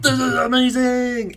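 slow, high excited shout; mock ecstatic yell; peaking-the-mic excitement. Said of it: "I'm sure we peaked the mic".

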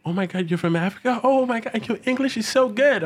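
high mock amazement; high gushing surprise. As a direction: gush it high like a surprised stranger. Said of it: "they're like".

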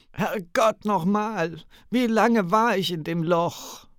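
high, loud desperate shout. Acted. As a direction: yell it out at him. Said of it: "Sie musste es herausschreien".

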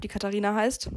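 ordinary delivery

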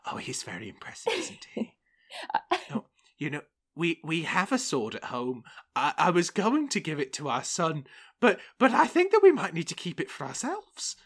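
put-on high, thin old lady's voice; old woman. Old wife fretting about the devil at the door. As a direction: chatter like a fretful old wife. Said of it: "And Cecily says".

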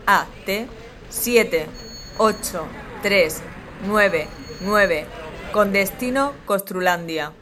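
PA boarding call; airport announcement. Acted. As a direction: read it like a gate announcement.

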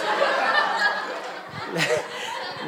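breathy high laugh; high burst of laughter. Real, not acted. Remembering locking himself in the lab, real laugh. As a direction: laugh out loud at the memory.

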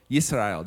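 Yisrael said the Hebrew way, stressed on the last syllable; Hebrew pronunciation. Shown as a demonstration. Preacher showing the congregation the Hebrew name, said the Hebrew way. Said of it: "that's how you would say it".

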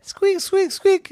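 very high-pitched squeaking; mouse squeaks. Acted. Field mouse greeting the robot, high squeaks. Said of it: "the field mouse said".

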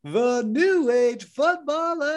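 slow, high booming announcement; ringmaster. Acted. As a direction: stretch out the show name. Announcing the show title.

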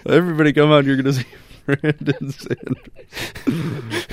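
loud, mock hype promo voice; mocking announcer. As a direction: imitate a pushy club promoter. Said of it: "I love how you just immediately go to".

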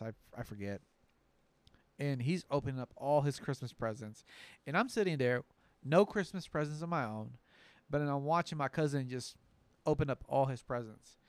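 ordinary talk

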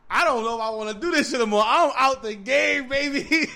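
hollered declaration. Acted. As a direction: holler it out playfully, stretching the end. Acting out quitting, hollered.

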